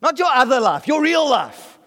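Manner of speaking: real lifted high and stretched out; stress on real. Real, not acted. Preacher to congregation, real pushed up high. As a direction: lean hard on the word real. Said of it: "stress on real".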